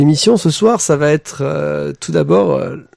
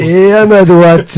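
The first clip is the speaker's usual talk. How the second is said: ring announcer; 5 semitones higher, 10 dB louder, slower than usual. Acted. Boxing-style introduction.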